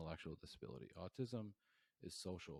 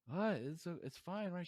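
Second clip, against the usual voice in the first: high, loud, bewildered protest; startled surprise; 12 semitones higher, 9 dB louder than usual. Retold or imitated. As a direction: act confused in a headlock. Caught in a headlock, retold.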